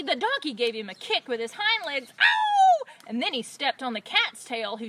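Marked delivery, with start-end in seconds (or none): 2.2-2.8 very high, loud "Ow!"; pained howl. Acted. Robber kicked by the donkey, high pained howl.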